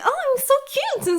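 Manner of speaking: very high, sugary cutesy squeal; mock cute voice. Mocking anime girls' cutesy talk, very high squeal. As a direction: parody an overly cute girl. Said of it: "If someone's like".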